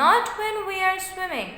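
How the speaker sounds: high-pitched, emphatic turtle comeback; turtle's retort. A narrator's reading. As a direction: answer back high and insistent. Turtle retorts to boasting bear.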